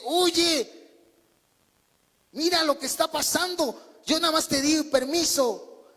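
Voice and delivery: mild complaint to a pushy camel; lightly voiced annoyed traveller; annoyed protest. Man scolding camel in tent.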